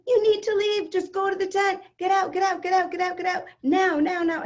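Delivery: high, loud repeated get out; yelling. Retold or imitated. Mother clearing the wedding hall, yelling at guests. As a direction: drive everyone out with shouted orders. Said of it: "And I'm yelling at everyone".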